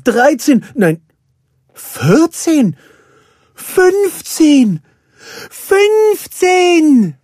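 very high, loud count building to a shout; excited calling. A narrator's reading. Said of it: "rief Nanny erneut". (immer aufgeregter rufend)

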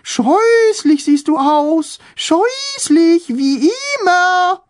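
high sing-song taunting voice; fluting high voice. A narrator's reading. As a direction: croon the insult up high. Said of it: "flötete Tom mit hoher Stimme".

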